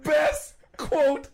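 high, loud words through laughter; laughing. Real, not acted. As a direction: gasp it out while still laughing. Still laughing after the story.

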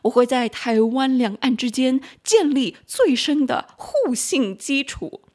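mainland Chinese accent, slow and grand. Shown as a demonstration. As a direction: read it like mainland state media. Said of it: "这边翻成中文就好中国人哦".

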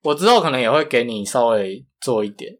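ordinary talk, nothing marked

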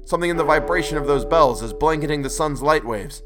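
urgent shouting. Acted. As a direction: press the point loudly over the din. Denton shouting urgently amid quake.

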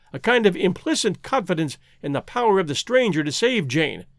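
ordinary delivery

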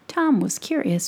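usual speaking voice

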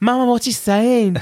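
high, lost and puzzled; a bewildered kid asking mum; confused plea. Retold or imitated. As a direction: ask like a baffled child. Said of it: "be like".

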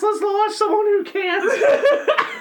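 high, slow, laughing. Real, not acted. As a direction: drawl it out cracking up.

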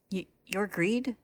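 slow, stammering disbelief; stuttering, confused. A narrator's reading. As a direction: trip over the first word, bewildered. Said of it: "Beto stutters, confused".

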